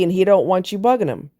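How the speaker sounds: ordinary delivery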